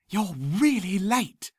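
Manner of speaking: hushed scolding schoolgirl; whispered girl voice. A narrator's reading. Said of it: "she whispered".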